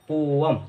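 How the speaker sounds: "पोअम" as po-am; correct pronunciation. Shown as a demonstration. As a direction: model "पोअम" alone, no y sound. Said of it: "right pronunciation पोअम होती है".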